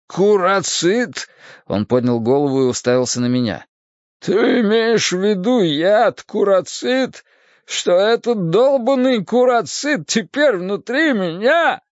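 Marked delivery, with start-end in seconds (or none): 0.1-1.3 high, drawn-out question; slow, pained astonishment; alarmed disbelief. Acted. Poisoned man learns of the poison. 4.2-11.8 high, slow, pained panic; drawn-out, high-pitched distress; alarmed disbelief. Acted. Poisoned man panics at the news.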